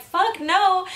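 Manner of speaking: high-pitched indignant refusal; mock outraged no; mad mom imitation. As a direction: mimic an angry mom flatly refusing, pitch jumping up. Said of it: "she got super mad at me".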